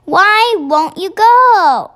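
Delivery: loud, high, drawn-out demand; pleading cry. A narrator's reading. Sophie begs the BFG to go, crying out. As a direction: cry out slowly, swooping high. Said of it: "Sophie cried".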